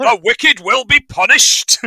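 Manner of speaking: hammed-up fire-and-brimstone line; lightly voiced zealous mother; quoting the mum. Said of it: "the mum comes out with this".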